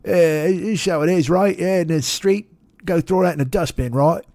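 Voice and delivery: hammed-up Cockney, pitched a little up; English accent. Shown as a demonstration. Gamer on Twitch making a character English, in broad mock Cockney. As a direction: put on a gleefully bad English accent. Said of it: "I always make somebody English".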